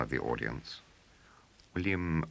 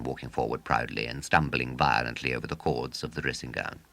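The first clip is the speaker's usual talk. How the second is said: plain reading voice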